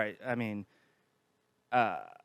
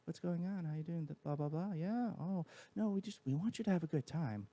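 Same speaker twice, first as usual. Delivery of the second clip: high and soft, friendly customer voice; sweet, gentle service voice; higher, softer voice; 5 semitones higher, 6 dB quieter than usual. Shown as a demonstration. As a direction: lift the pitch and soften it for strangers. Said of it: "I intentionally go, like, an octave higher and softer with my voice".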